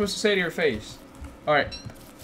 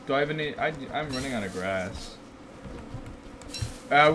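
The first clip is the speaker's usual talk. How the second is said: ordinary talk, nothing marked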